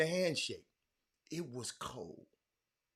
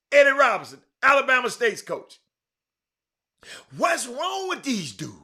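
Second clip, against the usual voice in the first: normal talking voice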